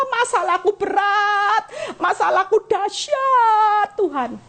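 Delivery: high, slowing, laughing complaint to God; mock whiny prayer. Preacher mimics congregant whining problems to God, high and whiny. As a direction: whine about every burden, half-joking. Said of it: "Ngomongnya apa coba jujur?".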